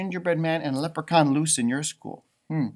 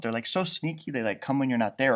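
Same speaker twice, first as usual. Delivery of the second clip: usual speaking voice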